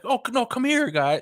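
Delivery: squeaky, high mock welcome; mock the school board inviting them in, pitched high; high welcoming voice. School board welcoming the newcomer, mock high and sweet.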